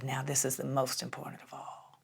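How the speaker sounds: put-on hushed old Southern drawl. Mentor introducing his final phrase, soft Southern drawl. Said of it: "That old Southern drawl".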